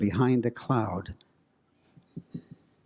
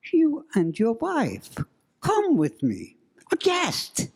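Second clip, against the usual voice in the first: put-on Middle European accent; 12 semitones higher than usual. Ghostly woman invites him and his wife, high Middle European accent. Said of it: "She had this strange Middle European accent".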